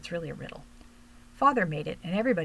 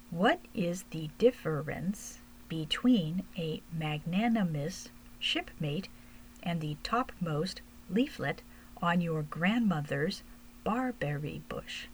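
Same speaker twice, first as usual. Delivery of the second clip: very slow, each word laid out with care; slow it right down, as if for a small child; slowly; slower than usual. Acted. Gnome boy slowly spelling out a long riddle to a little girl, very slow and careful. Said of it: "I'll say it slowly for you".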